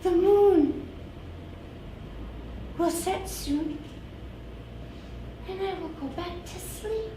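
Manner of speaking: high, fading flower voice; sleepy flower voice. Acted. Flower character voice.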